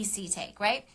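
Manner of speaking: ordinary delivery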